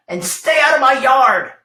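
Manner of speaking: grumpy cactus shouting at the jackrabbit, loud and angry; yelled. Acted. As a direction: bellow the order to leave. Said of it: "Hank yelled back".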